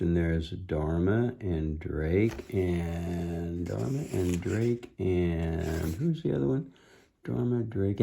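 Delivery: usual speaking voice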